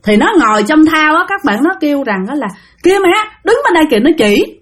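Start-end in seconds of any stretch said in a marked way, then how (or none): 2.8-4.0 put-on high, quick child's voice; four-year-old child. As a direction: point out the ghost to mom, high and urgent. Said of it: "đứa con của cổ lúc đó là bốn tuổi". (giọng bé bốn tuổi, cao và gấp)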